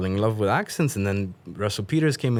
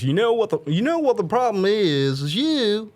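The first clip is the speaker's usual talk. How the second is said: Doctor Phil impression; 12 semitones higher, 4 dB louder than usual. Guest shows how he slips into Dr. Phil, higher. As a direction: lean in like a folksy TV therapist confronting a guest. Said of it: "in the case of Doctor Phil".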